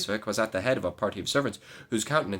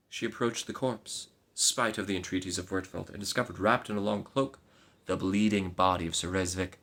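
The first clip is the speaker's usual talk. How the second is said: usual speaking voice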